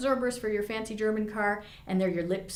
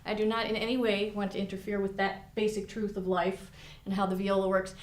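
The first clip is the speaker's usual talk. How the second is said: ordinary talk, nothing marked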